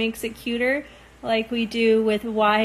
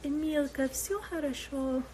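soft Russian, quieter, fond; gently, like grandma reassuring her; Russian grandmother; 4 semitones higher, 8 dB quieter than usual. Retold or imitated. A grandmother-in-law soothing Emily, softly in Russian. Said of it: "she calls me Emilka".

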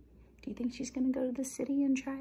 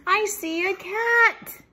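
high, loud, drawn-out cry; terrified storybook mouse; frightened squeak; 11 semitones higher, 15 dB louder, much slower than usual. A narrator's reading. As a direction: squeal each word in alarm. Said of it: "squeaked Annie suddenly".

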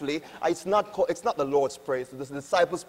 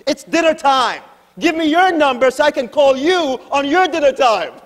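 higher, louder, a sassy retort to a collector; lady's comeback; 7 semitones higher, 6 dB louder than usual. Retold or imitated. Lady turning the tables on the collector, louder and higher. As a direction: fire the dinner-time threat right back, loud and high. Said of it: "She goes".

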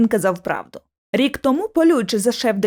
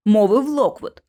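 ordinary delivery, nothing marked

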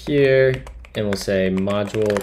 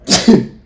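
a sudden loud sneeze; real sneeze bursting out; 9 semitones higher, 10 dB louder than usual. Real, not acted. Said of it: "I'm gonna sneeze".

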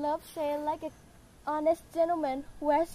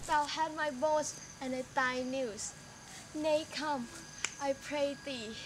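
soft pleading; 9 dB quieter than usual. Acted. Juliet begs nurse for news.

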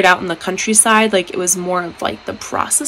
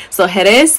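Jerez said heh-REZ, second syllable stressed; 把Jerez的重音放在第二个音节; 3 dB louder than usual. Shown as a demonstration. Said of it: "the emphasis on, is on the second syllable".

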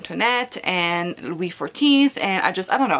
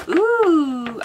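high, loud little ooh of delight; pleased surprise at the pretty book; 7 semitones higher, 7 dB louder than usual. Real, not acted. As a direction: react to something lovely. Opening new book mail.

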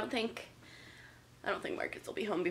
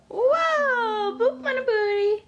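loud, much higher squeal; playful squeal; 12 semitones higher, 8 dB louder, slower than usual. Pretend. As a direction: squeal it to make the baby giggle. Exclaiming at baby, playful.

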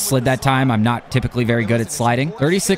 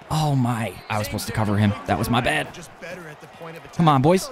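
ordinary talk

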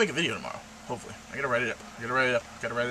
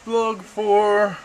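loud, high title call-out; mock announcement; 8 semitones higher, 10 dB louder than usual. Vlogger pointing out the vlog's number, loud mock announcement.

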